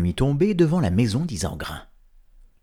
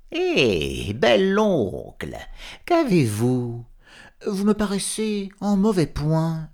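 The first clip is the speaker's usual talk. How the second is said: sly fox voice; 7 semitones higher than usual. Acted. Sly Renard feigning concern.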